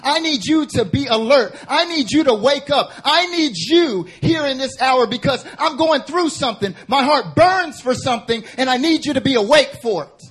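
high, loud, urgent preaching of God's plea; full-force preaching. Acted. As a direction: preach it as a cry to the congregation.

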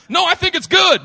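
high, loud, hopeful self-talk; eager inner voice rising high and loud. Retold or imitated. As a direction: talk yourself up high and bright. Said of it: "fighting with ambivalence".

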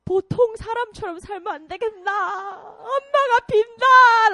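high, fast, loud pleading mother; 울며 애원하는 엄마 흉내; imitating weeping parents. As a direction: beg like a crying mother. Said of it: "weeping in front of me and saying".

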